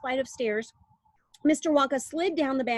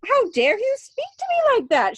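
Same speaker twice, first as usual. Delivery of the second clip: high, loud shrieking outrage; outraged shout; 14 semitones higher, 8 dB louder than usual. A narrator's reading. Missus Salt shouting at Wonka in outrage. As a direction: screech it in offended fury. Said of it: "shouted Missus Salt".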